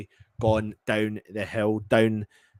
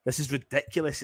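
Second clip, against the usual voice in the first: ordinary talk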